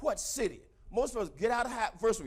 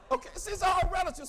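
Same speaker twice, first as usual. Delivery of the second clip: emphatic shout; 9 semitones higher than usual. Real, not acted. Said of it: "emphatic punchline".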